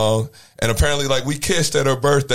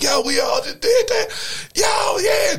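much higher, shouted hype, yelling with joy; hyped celebration; slower than usual. Retold or imitated. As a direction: whoop it up like you just pulled it off. Celebrating backstage after the show.

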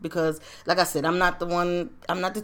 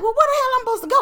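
much higher and louder, incredulous; outraged disbelief; 4 dB louder, much faster than usual. Retold or imitated. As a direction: throw the question back in disbelief. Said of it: "So I'm like".

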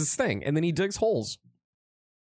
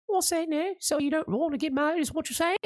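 put-on high-pitched botched Irish accent; attempted Irish accent; 13 semitones higher than usual. Woman challenging her boyfriend about marriage, botched Irish accent. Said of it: "They're Irish".

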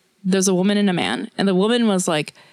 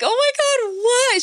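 very high-pitched mock squeal; thrilled disbelief. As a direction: reenact giddy amazement way up high. Reenacts delight at being recognized.

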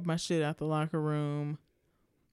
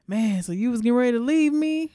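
higher and louder mock whine; 8 semitones higher, 11 dB louder, faster than usual. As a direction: imitate his loud, high whining complaint. Mimicking the guy's whiny question.

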